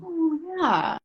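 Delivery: hammed-up tearful admission; mock tearful. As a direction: give in with a wobbly little sob. Admitting boyfriend is right, tearful.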